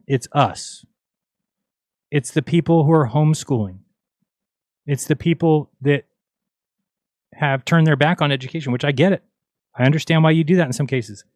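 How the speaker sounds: ordinary delivery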